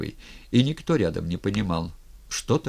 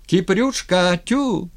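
high, loud hailing of a friend; excited, high-pitched holler; shouting from the shore; 10 semitones higher, 6 dB louder, slower than usual. A narrator's reading. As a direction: call out the name across the water. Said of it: "Зашумели от берега".

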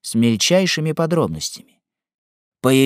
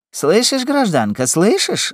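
high-pitched insistent shout; 9 semitones higher than usual. A narrator's reading. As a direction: call out sharply, demanding to be heard. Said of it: "закричал Симон". (крик, настойчиво)

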